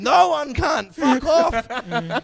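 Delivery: mock shout. Answering the cevapi sellers, a mock shout. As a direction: bark it back at the seller.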